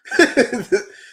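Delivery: burst of laughter. Real, not acted. Thrilled by the chapter.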